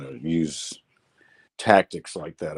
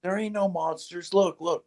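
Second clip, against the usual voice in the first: put-on higher, coaxing a child; 9 semitones higher, 4 dB quieter than usual. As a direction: talk kids out of fear. Grandpa reassuring grandkids.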